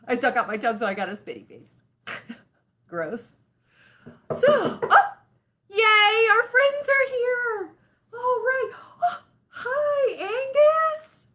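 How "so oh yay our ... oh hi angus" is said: excited. Acted. Host greets puppet friends arriving.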